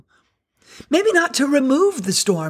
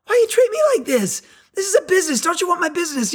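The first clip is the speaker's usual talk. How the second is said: higher, mock-offended outburst; indignant customer; 7 semitones higher, faster than usual. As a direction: play the wronged customer, a bit over the top. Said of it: "And I'm like".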